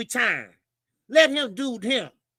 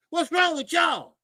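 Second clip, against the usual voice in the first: exasperated shout; 6 semitones higher than usual. Real, not acted. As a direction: throw it at the whole room. Same question repeated as a shout.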